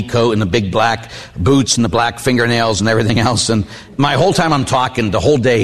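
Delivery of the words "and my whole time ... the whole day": ordinary delivery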